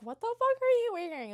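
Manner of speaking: high, loud, playful mock outrage; sing-song high teasing, not mean; campy teasing. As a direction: tease like a friend in a camp way. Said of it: "more like a camp way".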